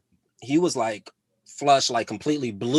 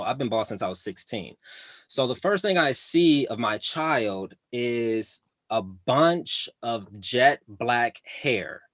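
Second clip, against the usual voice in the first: ordinary talk, nothing marked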